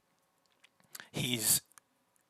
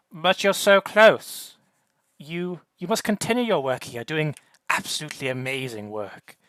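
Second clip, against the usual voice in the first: higher, theatrical gushing praise; flamboyant voice; 4 semitones higher, 5 dB louder than usual. Acted. Jovian praising his partner's work, flamboyant. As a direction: lay the flattery on thick, flamboyant. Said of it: "hearing the Jovian one say".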